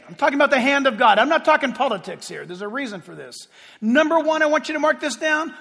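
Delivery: ordinary talk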